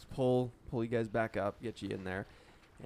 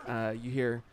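ordinary talk, nothing marked

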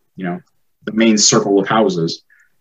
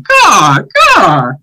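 high loud caw; screaming bird caw; 10 semitones higher, 6 dB louder than usual. Acted.

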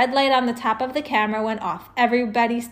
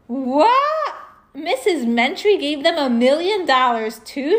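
high, louder cry of disbelief; outraged shout; 5 semitones higher, 4 dB louder, slower than usual. A narrator's reading. Andrea shouting at the rival school's news, outraged shout. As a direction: yell it like it's so unfair. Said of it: "shouted Andrea".

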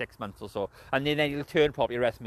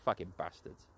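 usual speaking voice

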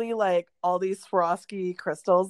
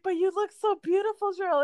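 gushing mom voice; 12 semitones higher than usual. Retold or imitated. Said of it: "my mom was like".

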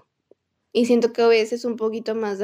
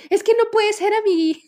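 mock whiny friend; 9 semitones higher, 4 dB louder than usual. Retold gamer's mock whine.

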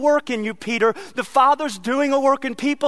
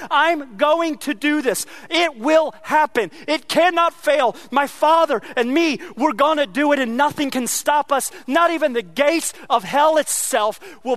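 higher, insistent preacher's drive; preacher voicing Jesus's promise with rising force; rising preaching; 4 semitones higher than usual. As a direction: build the certainty, press each claim.

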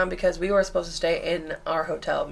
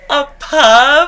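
high, loud mock disbelief; incredulous question; 8 semitones higher, 10 dB louder than usual. Teens invited to a pub by a stranger, incredulous echo. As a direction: throw it back, pitch jumping up.

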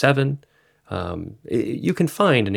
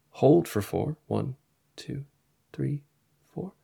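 slow breath counting; 3 semitones higher, 4 dB quieter than usual. Shown as a demonstration. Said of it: "I invite you to try right now".

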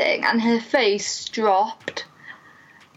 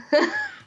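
a quick, much higher laugh; high burst of laughter. Real, not acted. Looking back on her stunned reaction, short laugh. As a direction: laugh at yourself.

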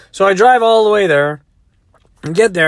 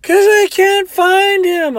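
high, loud, slow mock wail; hysterical crying; 14 semitones higher, 6 dB louder, slower than usual. Crying woman answers her doubting driver, hammed-up wail. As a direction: stretch it into an exaggerated bawl. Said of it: "She's crying".

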